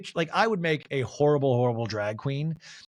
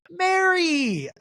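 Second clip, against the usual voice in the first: much higher and louder call across the room; 14 semitones higher, 4 dB louder than usual. Retold or imitated. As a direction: sing out her name to get her attention. Monica calling Mary over.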